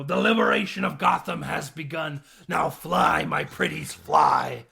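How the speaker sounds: loud, booming villain speech; mock villain proclamation. Penguin sending his troops on Gotham, loud mock proclamation. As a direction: proclaim it loudly to your troops.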